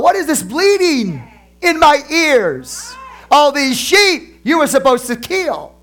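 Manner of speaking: high, slow indignant prophet's voice; Samuel's rebuke. Retold or imitated. Samuel rebukes Saul for sparing sheep, high and slow. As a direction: ham up an outraged prophet. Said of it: "Samuel said to Saul".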